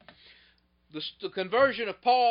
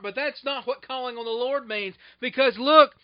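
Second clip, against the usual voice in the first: normal talking voice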